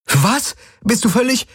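very high, incredulous outburst; aghast woman's voice; shocked protest. A narrator's reading. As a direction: protest in disbelief, pitch leaping. Shocked companion protests the order.

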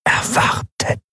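slow, unvoiced ghostly whisper; geisterhaftes Flüstern. A narrator's reading. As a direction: whisper it like a voice inside her head. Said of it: "die Flüsterstimme war die gleiche". (langsames, tonloses Geisterflüstern)

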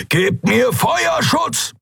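high, drawn-out battle roar. A narrator's reading. Keru calls to crewmates for covering fire, roaring. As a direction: bellow it slowly at a high pitch. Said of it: "brüllte Keru".

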